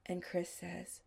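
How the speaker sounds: ordinary delivery, nothing marked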